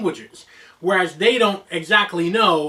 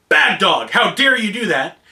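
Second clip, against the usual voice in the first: sharp demonstration of scolding a dog; changed scolding tone; 4 dB louder than usual. Shown as a demonstration. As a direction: scold the dog with emphatic inflection. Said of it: "changing my tone and my inflection".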